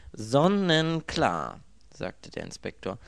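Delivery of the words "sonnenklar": high, loud, slowly stretched pronouncement; theatrical certainty. A narrator's reading. Inspector announcing his verdict to the victim, theatrically sure. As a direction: declare it grandly, very slowly.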